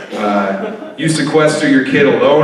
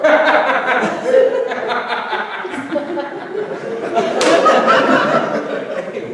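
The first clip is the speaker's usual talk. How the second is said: breathless laughter; 12 semitones higher, 3 dB quieter than usual. Real, not acted. Laughing during impression.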